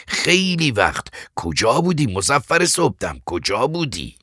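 loud, exclaiming, pitch leaping on key words; emphatic, rising exasperation; worked-up captor. Acted. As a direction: exclaim at finally catching your man. Captor greets long-sought man.

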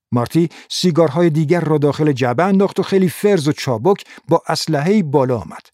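plain reading voice

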